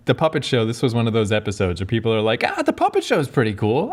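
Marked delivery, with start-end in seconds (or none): 2.5-3.9 mock fan voice. Mocking fans recommending episode.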